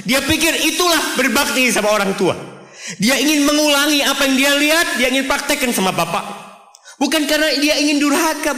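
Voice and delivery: high, loud impassioned sermon climax; fervent preaching. Acted. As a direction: drive the point home with full preaching fire. Preaches at full force.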